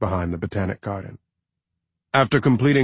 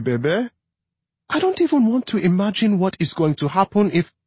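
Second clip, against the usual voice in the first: fearful; 11 semitones higher than usual. A narrator's reading. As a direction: confess her fear, close to tears. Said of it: "her voice cracking".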